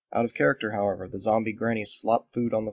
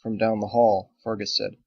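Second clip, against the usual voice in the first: plain reading voice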